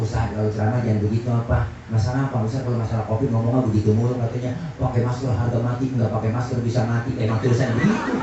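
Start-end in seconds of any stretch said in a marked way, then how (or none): 0.0-4.2 low, quicker retold complaint; a hint of a reproving officer, low; lightly voiced police officer. Police intel questions the preacher's sermons, lightly imitated low. 4.8-7.1 low, subdued recitation of a warning; retold quote. Preacher recalls a mask warning, low retold quote.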